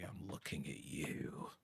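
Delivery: soft, slow, pointed aside; hushed aside. Pretend. Player calling out Stardew Valley, soft slow aside. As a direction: drop soft and draw it out. Jokey aside to another game.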